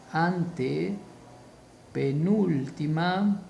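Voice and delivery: very slow, split as ante, penúltima; broken up slowly. Shown as a demonstration. Teacher repeating the key term, very slow and split up. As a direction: stretch each part of antepenúltima for learners.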